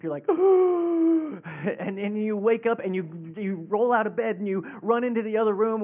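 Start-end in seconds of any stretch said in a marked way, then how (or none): 0.3-1.7 loud, high mock gasp; startled waking gasp. Parent woken by screaming at night, loud startled gasp. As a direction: gasp as if jolted out of sleep. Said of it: "it wakes you out of a dead sleep".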